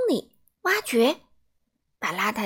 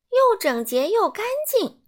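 much higher and louder, singsong; smug delight; 11 semitones higher, 6 dB louder than usual. A narrator's reading. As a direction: sing it out pleased. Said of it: "整洁先生说". (高声、得意洋洋)

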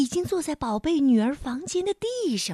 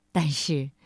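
ordinary delivery, nothing marked

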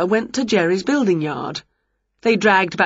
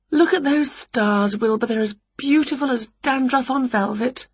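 gaze up at the stars in wonder; Winnie, dreamily admiring; 6 semitones higher than usual. A narrator's reading.

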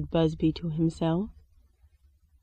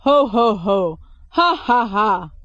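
loud spelt-out ho ho ho ha ha ha; a big jolly walrus laugh; hammed-up booming belly laugh; hearty laugh; 6 dB louder than usual. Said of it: "he laughed some more".

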